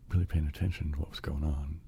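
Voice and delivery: usual speaking voice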